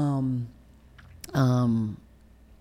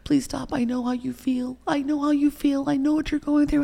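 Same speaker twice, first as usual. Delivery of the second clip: imitating a pleading voice; pleading quote; 12 semitones higher than usual. Quoting someone pleading with her.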